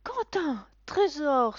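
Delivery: high, loud begging call; pleading mother. A narrator's reading. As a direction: plead with her son. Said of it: "supplie sa mère". (mère suppliante, voix aiguë)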